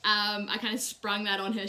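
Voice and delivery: ordinary delivery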